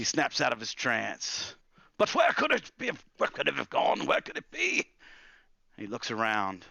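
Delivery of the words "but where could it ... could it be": put-on loud, high, frantic old wizard; panicked questions shooting up in pitch. Wizard hunting for the missing spellbook, frantic.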